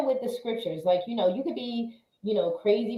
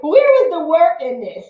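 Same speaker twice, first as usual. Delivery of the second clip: higher, louder, incredulous question; mock disbelief; 8 semitones higher, 9 dB louder than usual. Imagining attendees complaining about the easy workout, mock incredulous. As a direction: play the stunned workout attendee.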